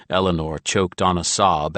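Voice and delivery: plain reading voice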